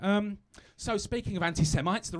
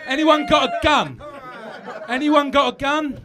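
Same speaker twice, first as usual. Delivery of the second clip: laughing; 8 semitones higher, 11 dB louder than usual. Real, not acted. Comic repeats line laughing.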